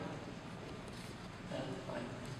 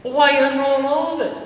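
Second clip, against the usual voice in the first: loud incredulous neighbor; 6 semitones higher, 6 dB louder, faster than usual. Retold or imitated. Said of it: "I said".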